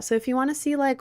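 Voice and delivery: ordinary talk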